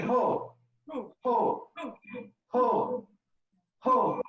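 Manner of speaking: belly ho ho ho; belly laugh ho. Shown as a demonstration. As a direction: push each ho from the belly. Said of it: "by saying".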